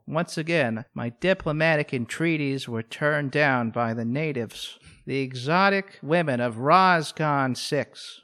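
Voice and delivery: low, measured log-entry narration; captain's log. Acted. [name] records his captain's log, low and solemn. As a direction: intone it like a starship captain's log. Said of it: "It's just him doing his captain's log".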